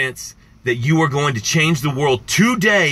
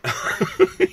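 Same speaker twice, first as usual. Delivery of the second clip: high delighted laugh; 14 semitones higher than usual. Real, not acted. Moved listener laughs high.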